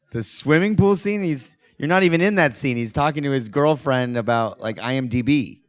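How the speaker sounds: high, loud, half-laughing disbelief; can't believe what he's hearing; retelling his own stunned reaction, voice jumping up; incredulous disbelief. Retold or imitated. Retelling Tommy's baffling favourite scene.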